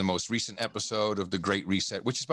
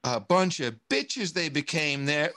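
normal talking voice

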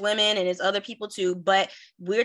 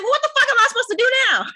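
much higher, louder and fast, exasperated yell; losing it; 9 dB louder, much faster than usual. Retold or imitated. Stranded at the party, yelling in furious disbelief. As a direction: blow up in outrage at being left. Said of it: "I would've lost my shit".

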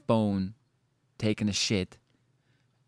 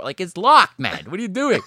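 louder, rising mock exasperation; exasperated; 7 semitones higher, 9 dB louder than usual. Addressing the absent cleaner, loud exasperation. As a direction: throw up your hands at him.

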